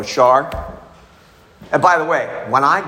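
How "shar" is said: louder call of a name; insistent retold call. Patient bursts into a staff meeting calling his counselor, insistent. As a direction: call it out to get her attention. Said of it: "I go".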